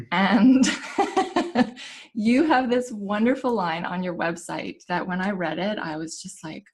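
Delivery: ordinary delivery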